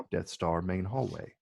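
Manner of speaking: normal talking voice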